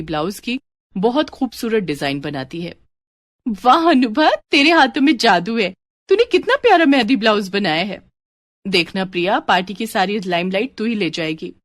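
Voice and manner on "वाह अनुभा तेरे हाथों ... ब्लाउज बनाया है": Priya gushing, higher and louder; delighted friend, thrilled by the design; excited praise. Acted.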